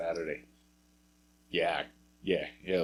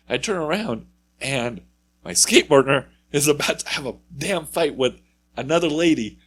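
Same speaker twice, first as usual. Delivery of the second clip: ordinary talk, nothing marked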